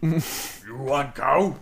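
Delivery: very slow, loud ogre question. Acted. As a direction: drag it out like a dull giant. GM voices ogre dialogue.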